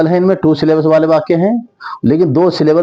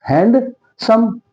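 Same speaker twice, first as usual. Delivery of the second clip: "Handsome" very slow, hand... सम, pitched higher; syllable split; 8 semitones higher, much slower than usual. Shown as a demonstration. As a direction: break "handsome" with a long pause before सम. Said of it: "दो syllable हो गए".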